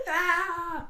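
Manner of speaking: high-pitched excited scream; re-enacted scream. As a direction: let out a high cry as if thrilled by the waves. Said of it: "I was screaming".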